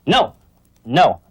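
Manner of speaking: loud, harsh correction no; firm loud no to the puppy. Shown as a demonstration. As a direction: bark a sharp no at the puppy. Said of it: "I used the harsh tone of voice no".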